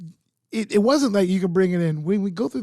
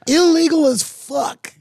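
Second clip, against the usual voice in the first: excited outburst; 9 semitones higher, 8 dB louder, slower than usual. Real, not acted. Joking about Qatar's alcohol ban.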